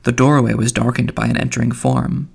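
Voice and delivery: plain reading voice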